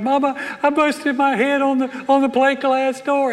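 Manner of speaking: put-on high childish voice telling mama about a hurt head; whiny child voice. Pastor retelling telling mama he busted his head, high childish whine. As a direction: play the hurt little boy. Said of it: "I'm like".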